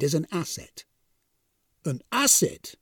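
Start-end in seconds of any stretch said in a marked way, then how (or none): 1.8-2.7 higher, questioning echo of disbelief; incredulous echo. A narrator's reading. Death echoes the supervisor's news, incredulous. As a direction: repeat it back as if you can't believe it. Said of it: "echoed Death incredulously".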